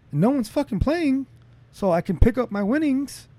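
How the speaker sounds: normal talking voice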